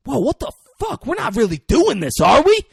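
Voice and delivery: loud, high mock shock; oblivious disbelief. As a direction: exclaim like someone clueless about the danger. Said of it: "Just oblivious".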